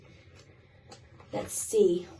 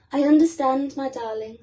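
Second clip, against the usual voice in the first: mummy penguin; 3 semitones higher, 8 dB louder than usual. Acted. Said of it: "said his mummy".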